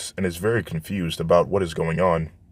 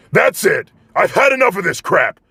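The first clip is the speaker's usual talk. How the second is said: much higher, louder furious outburst; angry yell; 12 semitones higher, 5 dB louder than usual. Acted. Bakugo snaps at the class group: furious yell. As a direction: explode at the whole class. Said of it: "yells out loud".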